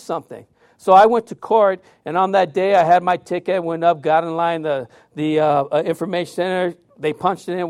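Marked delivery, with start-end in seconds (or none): none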